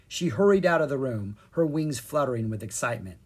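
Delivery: ordinary delivery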